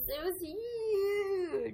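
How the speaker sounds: high, very drawn-out parody; hammed-up presidential catchphrase, very slow; Trump. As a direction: stretch "huge" like a mocked politician. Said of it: "do your Trump impression".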